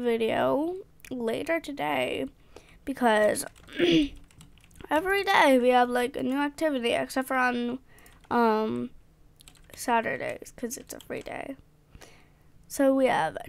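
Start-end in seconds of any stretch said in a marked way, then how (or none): none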